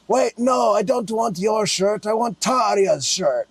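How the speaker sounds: high, slow put-on fan voice; Croatian fan. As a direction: imitate the fan turning it down. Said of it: "they say".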